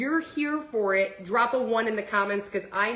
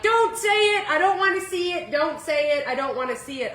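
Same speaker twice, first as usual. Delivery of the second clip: high, loud panicked don't say it; panicked plea; 6 semitones higher, 5 dB louder than usual. Retold or imitated. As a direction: beg the nurse loudly, high-pitched. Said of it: "and I'm like".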